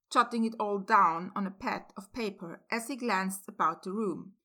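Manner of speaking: ordinary delivery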